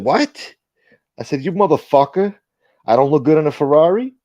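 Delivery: high-pitched, offended comeback; indignant disbelief. Retold or imitated. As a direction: retell it as an outraged, rising question. Acted argument over the car.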